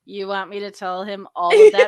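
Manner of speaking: loud laughing disbelief; can't believe the length; mock outraged disbelief; incredulous. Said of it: "the other guy's like".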